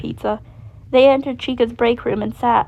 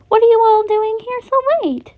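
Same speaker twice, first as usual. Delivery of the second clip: high, bright-voiced question; cheerful chicken asking; chirpy Chica; 11 semitones higher, 5 dB louder than usual. A narrator's reading. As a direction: sing the question sweetly.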